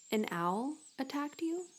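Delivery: put-on a worried mother checking her child; Mother Bat voice.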